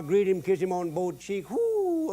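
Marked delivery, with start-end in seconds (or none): 1.5-2.1 high joyful whoo; papa's high welcoming whoo; happy whoop. Retold or imitated. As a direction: whoop with delight at the son. Papa greeting returning son.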